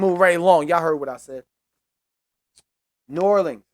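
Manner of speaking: loud, high-pitched dismissal; brusque insistence. Real, not acted. Host moving on to New Orleans, loudly insistent. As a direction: shut down discussion and call the next team. Calls out next team emphatically.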